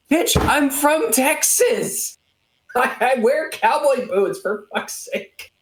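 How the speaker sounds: high, slow mock indignation trailing to a hiss; indignant outburst. Retelling snapping at a doubting caller, mock fury. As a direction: lay down the law, half-laughing, fading out. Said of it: "I'm like".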